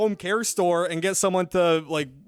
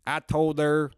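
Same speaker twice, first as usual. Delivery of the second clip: deadpan Southern comic character; Chuckleberry Finn. Acted. Comic performing his joke for the host, Southern accent. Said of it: "try saying them in a Southern accent".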